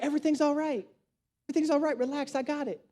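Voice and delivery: hushed, retold reassurance; soft reassurance. Man reassures worried date at the cash register, hushed and soothing.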